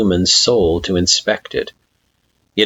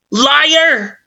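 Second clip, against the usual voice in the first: angry shout; 5 dB louder than usual. Acted. Flowey accuses.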